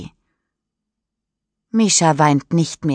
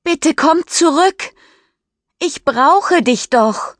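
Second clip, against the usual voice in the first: much higher and louder, slowing on the end; desperate call; 11 semitones higher, 4 dB louder than usual. Acted. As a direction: beg him to come back, calling out. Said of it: "ruft sie jetzt laut in die Nacht". (verzweifelt laut rufen)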